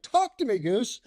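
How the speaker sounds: very high, urgent mock plea; frantic movie-line plea. Pilot alone on the radio, frantic high plea. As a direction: throw the line out high and desperate. Retold desperate radio call.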